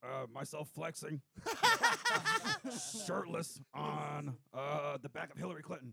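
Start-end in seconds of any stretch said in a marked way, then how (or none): 1.2-3.0 high giggle. Real, not acted. Giggles mid shirt idea.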